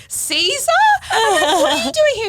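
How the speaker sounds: very high, loud surprise; excited, high-pitched retold shock; astonished recognition. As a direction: squeal it in acted disbelief. Retelling surprise at recognising old acquaintance.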